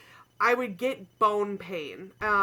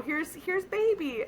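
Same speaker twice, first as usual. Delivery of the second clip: higher, slow sing-song offer; cooing nurse; 9 semitones higher, slower than usual. Retold or imitated. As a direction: soothe like a nurse distracting a patient. Nurse voiced offering the baby.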